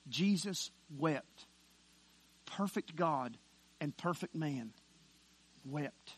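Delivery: soft, low, slowly measured phrases; hushed, solemn. Acted. Preacher dwelling on perfect God who wept, hushed and solemn. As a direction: drop low and leave space round each phrase.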